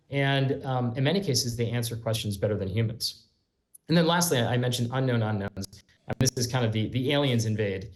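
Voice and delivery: ordinary talk, nothing marked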